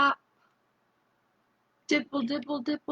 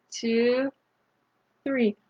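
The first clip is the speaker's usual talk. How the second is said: ordinary delivery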